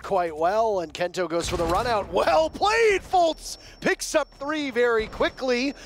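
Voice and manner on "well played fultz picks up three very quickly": high, loud play-by-play roar; excited shout. Real, not acted. Caster erupting as Fultz picks up three kills. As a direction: yell the triple kill.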